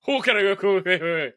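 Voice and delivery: high, drawn-out garbled mock Scottish; botched Scottish accent. Actor failing a Scottish audition, botched Scottish accent. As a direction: attempt Scottish and badly miss. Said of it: "he couldn't do Scottish".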